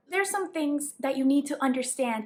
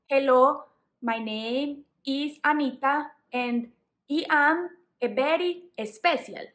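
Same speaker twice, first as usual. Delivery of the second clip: heavy Spanish accent, careless English pronunciation. Shown as a demonstration. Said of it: "didn't care about my pronunciation".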